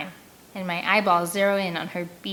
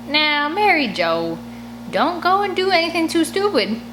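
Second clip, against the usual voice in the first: Otis's drawling warning; 8 semitones higher than usual. Acted. Otis voiced warning Mary Jo.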